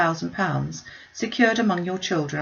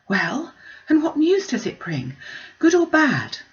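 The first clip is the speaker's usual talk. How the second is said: anxious; 9 semitones higher, 3 dB louder than usual. Acted. Anxious daughter pressing for news.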